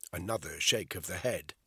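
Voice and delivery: ordinary delivery, nothing marked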